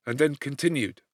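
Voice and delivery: ordinary delivery, nothing marked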